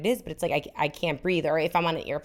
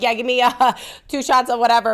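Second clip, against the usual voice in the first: higher, louder cheerful request; eager order; 6 semitones higher, 6 dB louder than usual. Retold or imitated. Ordering drinks on the plane, eager and louder. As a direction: ask for shots gladly. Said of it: "I'm like".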